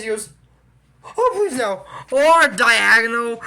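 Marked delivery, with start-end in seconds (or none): 1.0-1.8 high-pitched pleading, hammed-up; mock dismay. Student reacting to a sideways classroom, mock dismay. 2.1-3.4 loud, slow, drawn-out teacher voice; hammed-up announcement. Eccentric teacher topping his list for students, hammed-up announcement. Teacher gleefully adds another option.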